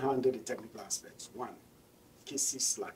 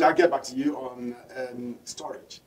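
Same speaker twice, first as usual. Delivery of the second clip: usual speaking voice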